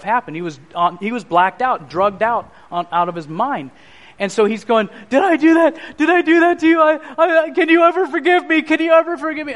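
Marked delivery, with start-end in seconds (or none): 5.1-9.5 high, distraught begging; retold tearful plea, pitched way up; imitated breakdown. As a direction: imitate a father pleading in anguish. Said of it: "he loses it".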